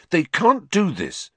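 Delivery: put-on outraged young Harry; upset protest. A narrator's reading. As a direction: protest in disbelief. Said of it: "said Harry".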